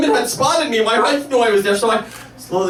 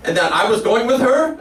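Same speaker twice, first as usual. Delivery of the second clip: usual speaking voice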